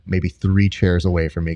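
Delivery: ordinary delivery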